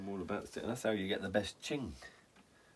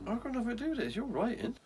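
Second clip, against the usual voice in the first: mock innocent; 4 dB louder than usual. Denying blame, mock innocent.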